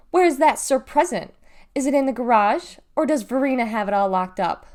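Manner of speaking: loud, mocking questions at her sister; sniping taunt. Galveston taunts Deli about the Sir Present, loud sniping. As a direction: needle her, loud and snide. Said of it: "she sniped at her".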